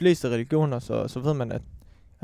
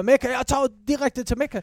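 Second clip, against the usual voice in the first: mock-eager voice, higher; mocking quote; 8 semitones higher than usual. Mocking his friend's eagerness to go straight to Mecca, higher mock voice. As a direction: parrot him as an overeager pilgrim. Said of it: "du, du siger".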